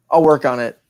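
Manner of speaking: ordinary talk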